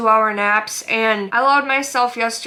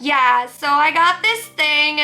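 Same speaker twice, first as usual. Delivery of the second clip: higher and slow, a put-on fashion vlogger voice; mocking influencer voice; 5 semitones higher, slower than usual. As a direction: mimic a try-hard clothing YouTuber with slow high delivery. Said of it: "they talk like this".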